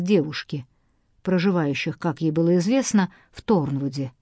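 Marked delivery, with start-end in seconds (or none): none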